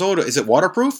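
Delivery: mock innocent question. Tone teasing Stefan before throwing the pager in the tank: mock innocent question. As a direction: play dumb before the prank. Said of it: "Tone walks over and goes".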